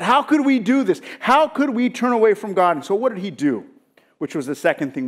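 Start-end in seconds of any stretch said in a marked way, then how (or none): 0.0-2.8 raised, higher-pitched dismayed protest; acting out an upset, reproachful plea. Preacher voicing Ezra, appalled at his people turning from God, upset. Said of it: "He's upset".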